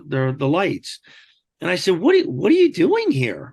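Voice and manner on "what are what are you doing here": high, startled disbelief at the door; stunned question. Retold or imitated. Homeowner startled by armed agents at his door, high stunned question. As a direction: blurt it out, caught off guard. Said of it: "that's exactly what I said".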